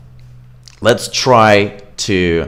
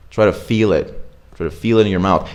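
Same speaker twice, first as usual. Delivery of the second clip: normal talking voice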